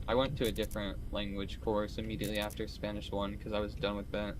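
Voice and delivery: usual speaking voice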